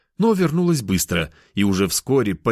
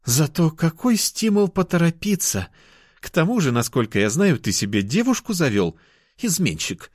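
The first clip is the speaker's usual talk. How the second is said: put-on higher, teasing girl's voice; tease, then pout the accusation; pouting cat girl; 8 semitones higher than usual. A narrator's reading. Girl chiding her lover, high and pouty. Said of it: "надула она губки".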